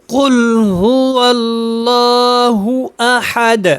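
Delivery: قل هو اللہ احد slow, stopping on احد; full recitation. Shown as a demonstration. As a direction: recite the whole ayah. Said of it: "اب اس کو اکٹھا پڑھتے ہیں". (پوری آیت تلاوت)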